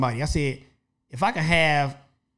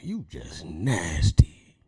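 whisper; 13 semitones lower, 6 dB quieter, much slower than usual. Retold or imitated. Voiced thought, whispered.

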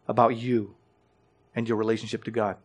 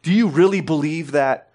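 challenging, louder mock-incredulous question; skeptical angry tone; 3 semitones higher, 6 dB louder than usual. Angry audience member challenging the preacher, incredulous. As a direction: sneer the doubt like a hostile questioner. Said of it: "you get that tone".

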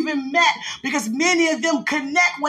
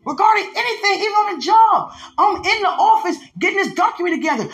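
fervent preaching; 4 semitones higher than usual. Acted. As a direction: preach it with urgency. Preaching rises again.

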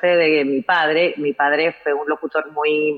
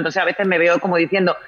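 usual speaking voice

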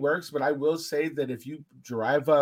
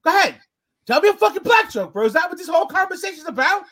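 much higher and louder, angry confronting challenge; getting loud; 11 semitones higher, 8 dB louder than usual. Retold or imitated. Challenging the drunk guy at the bar, angry and loud. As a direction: demand it, building in anger. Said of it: "now I've gotten loud".

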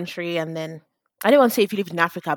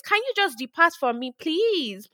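higher, mock Texan-Nigerian twang; Agege Texas accent; 5 semitones higher than usual. Shown as a demonstration. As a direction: put on a fake Texas accent. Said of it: "Texas accent".

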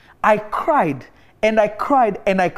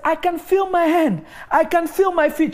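higher, amazed voice of the woman; amazed healed woman; 7 semitones higher than usual. Retold or imitated. Paralyzed woman telling him she feels her body, high and amazed. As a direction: let the wonder lift the voice.

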